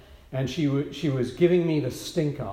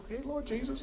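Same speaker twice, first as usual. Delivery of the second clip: much softer, higher worried plea; nervous prayer; 7 semitones higher, 14 dB quieter, faster than usual. Retold or imitated. Pastor asking the Lord for help before a hard talk, softly. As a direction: breathe a nervous little prayer.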